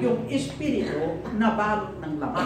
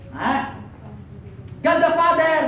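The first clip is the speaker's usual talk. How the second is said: ordinary talk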